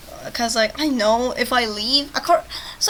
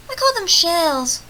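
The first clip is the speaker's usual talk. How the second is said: character retort; 6 semitones higher, 5 dB louder, slower than usual. Acted. Voices game character's retort.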